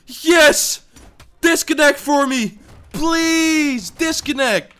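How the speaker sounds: high, loud pleading yells; excited begging. Real, not acted. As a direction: beg the opponent to rage quit. Begging opponent to quit, excited.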